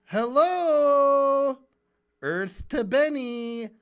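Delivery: loud, high, drawn-out hello; mock calling out. Host hailing an oblivious debater, exasperated mock calling out. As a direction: call out slowly as if to someone spaced out.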